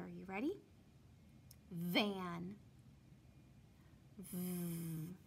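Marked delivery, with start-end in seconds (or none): 4.3-5.2 "V" said as the bare sound; first sound. Shown as a demonstration. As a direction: hum a short buzzing "V". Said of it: "tell me the first sound".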